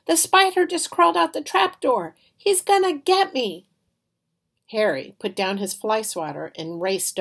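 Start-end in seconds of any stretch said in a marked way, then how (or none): 0.1-3.7 high, loud panicky cry; panicked. Acted. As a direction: shout in fright about the spider. Sydney panics about spider.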